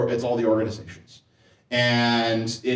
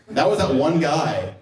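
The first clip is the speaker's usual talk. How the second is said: ordinary talk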